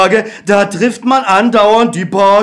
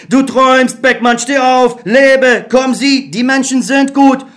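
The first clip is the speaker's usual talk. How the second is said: higher, urgent inner voice; optimistic Other; 5 semitones higher than usual. Acted. The Other urging Beckmann to trust people. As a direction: coax him to believe.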